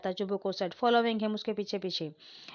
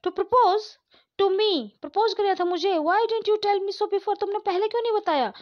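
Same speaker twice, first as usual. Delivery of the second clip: shocked outcry; 8 semitones higher, 4 dB louder than usual. Acted. Daughter shocked by proposal.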